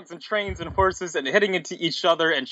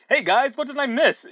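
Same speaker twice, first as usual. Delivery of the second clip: high, breezy mock shout; cool, cheerful shout; 9 semitones higher than usual. A narrator's reading. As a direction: call out casually to the gang. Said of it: "shouted Sonic in his irregular, cool, old, cool way".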